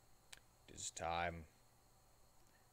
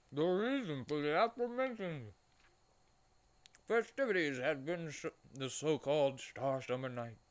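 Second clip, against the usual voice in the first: higher, louder theatrical storyteller; grand narrator voice; 6 semitones higher, 5 dB louder than usual. Acted. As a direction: puff it up like an epic narrator.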